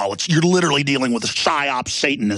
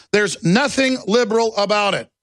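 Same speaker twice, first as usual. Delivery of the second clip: normal talking voice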